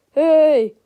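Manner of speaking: loud, high whiny call; whining. A narrator's reading. Freddie protests about his ruined volcano, whining. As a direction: whine out your protest. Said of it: "whined Freddie".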